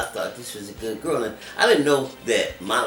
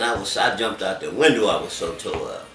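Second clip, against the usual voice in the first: ordinary talk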